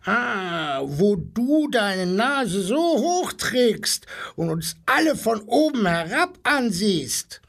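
a hint of a mocking parrot; screeching parrot. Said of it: "kreischte der Papagei". (leicht angedeuteter Papagei, hoch und spöttisch)

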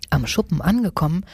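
ordinary delivery, nothing marked

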